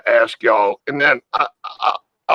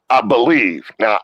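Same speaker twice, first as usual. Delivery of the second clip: usual speaking voice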